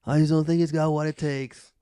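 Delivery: lightly voiced Triple H brushing off a challenger; mock Triple H, dismissive; Triple H impression. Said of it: "he's like".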